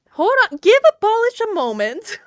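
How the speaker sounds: high, loud mock plea; playful call for patience. Streamer telling chat to wait, playful high shout.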